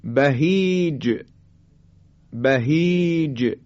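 بهیج drawn long, ending on a bounced ج; slow recitation of بهیج with echoing ج; qalqalah on final jim. Shown as a demonstration. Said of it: "حرف جیم".

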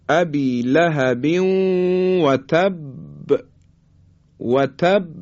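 slow recitation, تب held shut then bounced on ب; qalqalah on doubled ba. Shown as a demonstration. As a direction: hold the ب of تب and release it with an echo. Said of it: "این صفت قلقله هست در حرف با".

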